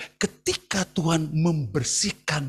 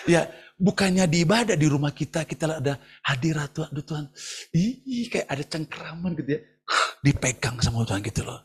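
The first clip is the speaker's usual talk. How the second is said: ordinary delivery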